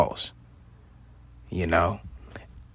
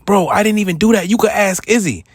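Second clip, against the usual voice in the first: higher and louder, protesting his innocence; pleading denial; 9 semitones higher, 5 dB louder than usual. Retold or imitated. Assistant manager protesting to the boss, loud pleading. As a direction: insist desperately, pointing to a witness.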